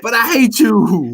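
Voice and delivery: high, slow mock hatred; mock hate. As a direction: drag out the fake grudge. Friend voicing love-hate for a pet.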